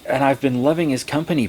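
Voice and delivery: normal talking voice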